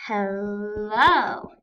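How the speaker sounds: hello drawn out, leaning on the -lo; stress on second syllable. Shown as a demonstration. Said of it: "We stress the second part of the word".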